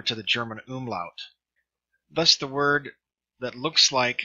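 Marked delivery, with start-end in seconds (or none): none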